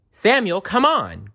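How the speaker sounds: put-on high urgent boy's call; boy hurrying his cousin; eager cry. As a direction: hurry your cousin along. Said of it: "cried Jerem".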